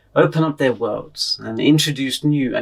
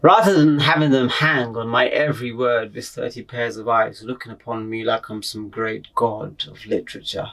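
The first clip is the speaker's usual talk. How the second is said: normal talking voice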